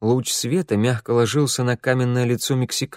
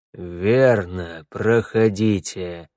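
low, slow metallic knight voice; a hint of an armoured robot, slow; booming metallic robot; 3 semitones lower, much slower than usual. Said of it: "ответил металлический голос".

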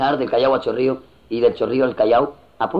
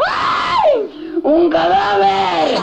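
very high, drawn-out mock shriek; hammed-up horror, stretched and high-pitched; screaming lady; much slower than usual. Lady spotting a corpse on the beach, mock scream. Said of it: "pasó una señora que gritó".

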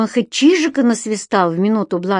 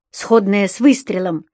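ordinary delivery, nothing marked

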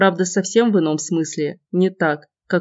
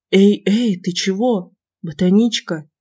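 alarmed calling out; 2 semitones higher than usual. Acted. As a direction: call her name in alarm. Lina collapses in shower.

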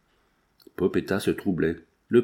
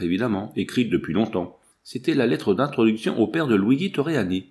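ordinary delivery

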